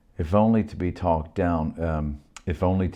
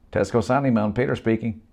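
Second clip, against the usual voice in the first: higher, louder phone greeting; Peter answering; 7 semitones higher, 4 dB louder than usual. Acted. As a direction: answer the shop line brightly.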